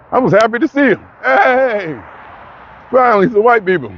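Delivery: high, hammed-up joyful cheer; joking mock cheer at a commercial; mock excitement. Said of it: "I'm playing kinda".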